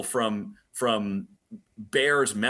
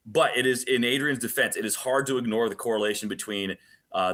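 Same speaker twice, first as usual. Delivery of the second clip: ordinary talk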